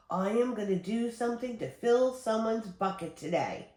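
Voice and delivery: ordinary delivery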